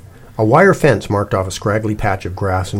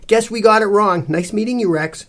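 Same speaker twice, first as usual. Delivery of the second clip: high, breezy goodbye; polite farewell; 12 semitones higher, 3 dB louder than usual. A narrator's reading. Cece leaving the lady and her dog, polite. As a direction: sign off cheerily, easing down on the dog's name.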